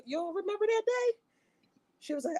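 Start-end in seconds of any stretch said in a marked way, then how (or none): 0.0-1.2 high disbelieving question; incredulous. Retold or imitated. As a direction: ask it in disbelief. Son incredulous with mom.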